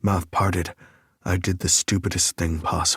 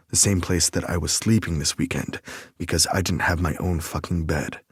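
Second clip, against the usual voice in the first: usual speaking voice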